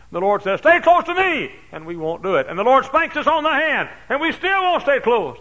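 high, loud fervent preaching; preaching at full force. Acted. Pastor preaching to his congregation, full force. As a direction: drive the sermon home to the crowd.

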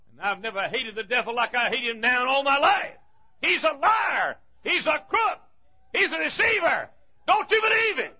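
high, loud shouted denunciation; fiery preaching. Acted. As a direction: thunder against the devil. Preaching at full force against devil.

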